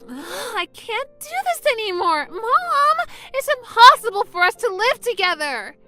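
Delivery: acted fed-up outburst; exasperated complaint. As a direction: protest that you've had enough. Daughter complains to mother.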